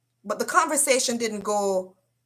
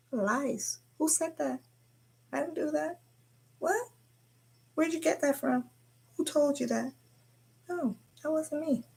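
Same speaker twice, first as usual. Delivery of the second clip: pitched up, playing wide-eyed innocence; mock innocent denial; 6 semitones higher, 6 dB quieter than usual. Mimicking his teenage self, a higher playful innocent denial. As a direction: protest like someone accused, hammed up.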